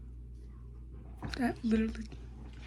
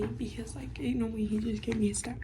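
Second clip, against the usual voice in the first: whisper; 9 dB quieter than usual. Real, not acted. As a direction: whisper so nobody nearby hears. Whispering the story.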